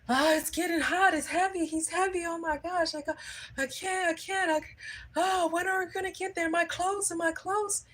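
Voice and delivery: very high-pitched, whiny mock wail; mock crying. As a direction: imitate an exhausted man wailing in complaint. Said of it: "He starts crying. He's like".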